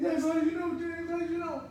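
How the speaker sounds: high, loud, amazed questioning; retold amazement. Jailmate amazed his fiance's beauty was guessed, high loud exclaiming. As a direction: exclaim it high, astonished he could know.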